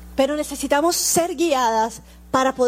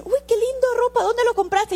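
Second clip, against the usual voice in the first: put-on much higher, gushing girlish delight; gushing compliment; 10 semitones higher than usual. Stranger on the street admiring her clothes, gushing and high-pitched. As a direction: squeal a compliment at a stranger's outfit.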